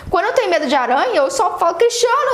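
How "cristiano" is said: calling shout. Retold or imitated. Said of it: "eu dou um grito".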